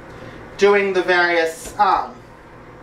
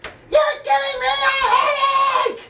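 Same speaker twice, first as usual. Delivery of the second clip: much higher, shrieking witchy voice; Rita Repulsa impression; slower than usual. Fan imitating Rita scolding the Rangers, high shriek. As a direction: screech it high and slow like a cranky witch. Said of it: "Rita was like".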